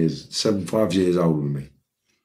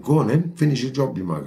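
high-pitched goading dare; defiant jeer, pitched well up; acted taunt; 7 semitones higher than usual. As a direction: re-enact egging on an attacker. Retold taunt at the shooter.

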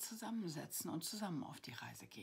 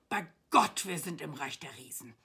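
loud oath of astonishment; acted loud cry; calling out; 11 dB louder than usual. A narrator's reading. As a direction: exclaim as an amazed knight. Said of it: "rief der Ritter".

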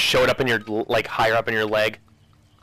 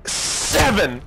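excited counting; 8 semitones higher, much slower than usual. Real, not acted.

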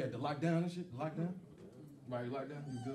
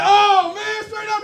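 excited; 13 semitones higher, 12 dB louder, much slower than usual. Retold or imitated. Excited quote to Nigerian guy.

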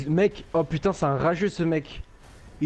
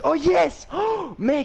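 high, loud cry of joy; excited shout; 11 semitones higher, 3 dB louder, much slower than usual. Real, not acted. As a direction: yell out in delight. Gets an exotic helmet drop. (cri de joie aigu)

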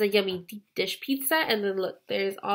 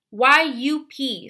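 slow letter-by-letter spelling; each letter set apart, drawn out; 3 semitones higher, 7 dB louder, much slower than usual. A narrator's reading. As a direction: spell Y U P one letter at a time. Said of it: "Stanley spells".

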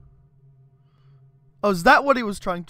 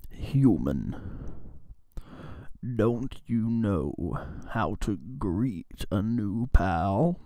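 much lower, slow, menacing; creepy character voice; 11 semitones lower, 4 dB quieter, slower than usual. Acted. Streamer voicing a creepy character greeting the human, slow low menace.

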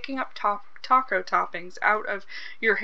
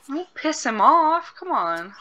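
tense pleading; 5 semitones higher, 4 dB louder, slower than usual. Real, not acted. Pleading with the game.

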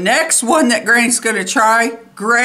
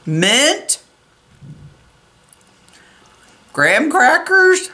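talking while tasting; savouring a cold spoonful; mouth full of ice cream. Real, not acted. Seen 0: holds spoon of ice cream, mouth working, eyes closed while savouring.

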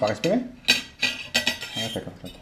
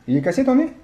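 surprised; 10 semitones higher than usual. Real, not acted. Parent alarmed at broken nose.

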